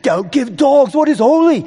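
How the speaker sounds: put-on high, loud, sarcastic British accent; mock them in a loud, high-pitched British voice. Preacher voicing Jesus mocking the self-righteous, mocking British accent. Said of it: "you wanna do it in a British accent".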